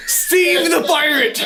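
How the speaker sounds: high, loud, drawn-out cry through laughter; laughing exclamation; mock triumphant shout. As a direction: holler it playfully, slowly. Mock outburst after botched line.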